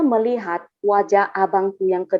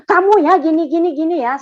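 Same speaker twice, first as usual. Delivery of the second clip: raised, high mock telling-off; imitated scolding; 6 semitones higher, 4 dB louder than usual. Believer shows how they used to talk at people, scolding. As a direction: act out your old accusing rant, starting loud. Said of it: "Dulu kalau saya ngomong".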